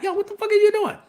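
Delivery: a hint of a puzzled emperor; Palpatine voice. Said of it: "Papa Palpatine looking at him like".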